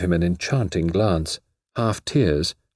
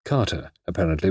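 usual speaking voice

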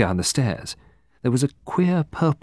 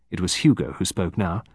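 ordinary delivery

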